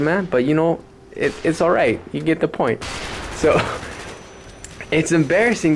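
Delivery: ordinary talk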